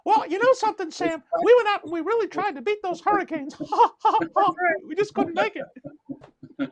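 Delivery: high, squeaky cartoon mouse talking to Sam; a giddy cartoon mouse admitting defeat; Mickey Mouse voice. Coach speaking to Sam as Mickey Mouse. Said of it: "using the Mickey Mouse voice".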